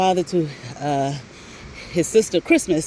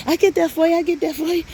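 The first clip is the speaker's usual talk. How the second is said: mock boyfriend; 10 semitones higher, faster than usual. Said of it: "boyfriend's breezy promise".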